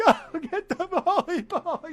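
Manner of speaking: high, drawn-out mock wail; hammed-up distress with a laugh; freaking out. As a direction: wail it in comic panic, half laughing. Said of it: "I'm just freaking out in the theater".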